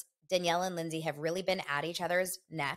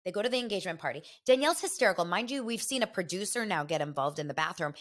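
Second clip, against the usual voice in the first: ordinary talk, nothing marked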